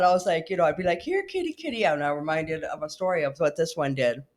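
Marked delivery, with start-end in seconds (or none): 1.0-1.9 high sing-out to a cat; kitty call. Pretend. Pretending to call a fox like a cat, high coaxing. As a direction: coax a cat over in a high voice.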